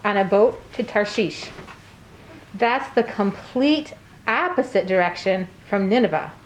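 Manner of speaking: ordinary delivery, nothing marked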